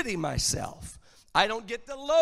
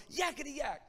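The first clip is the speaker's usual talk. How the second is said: put-on small, high, softer child voice; little rebellious voice; 5 semitones higher, 7 dB quieter, faster than usual. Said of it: "this little rebellious voice".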